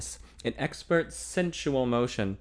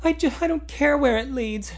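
put-on higher, louder, feverish young woman; 5 dB louder than usual. A narrator's reading. Young woman to the man she loves, feverishly. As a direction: blurt it out in a fever. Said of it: "she replied feverishly".

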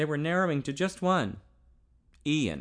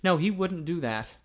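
ordinary delivery, nothing marked

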